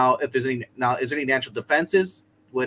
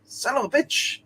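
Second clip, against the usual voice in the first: higher-pitched exasperated curse; Dracula; 13 semitones higher than usual. Acted. Game master voices Dracula losing the Darkhold, high exasperated curse. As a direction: curse in a raised, vexed pitch. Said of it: "Dracula's gonna be like".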